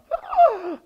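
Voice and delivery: very high, loud gasp; loud mock gasp. Disciples hear Jesus is leaving, loud mock gasp. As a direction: gasp big for laughs.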